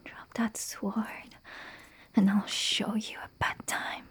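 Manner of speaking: menacing whisper. Acted. Threatens thief with blade.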